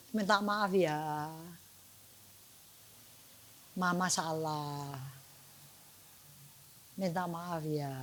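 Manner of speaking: soft, slow, low murmur; tender apology. Acted. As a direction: apologize gently while cuddling a child. Mother hugs child, apologizing. (minta maaf lembut, pelan, penuh sayang)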